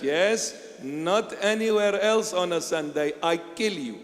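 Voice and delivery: usual speaking voice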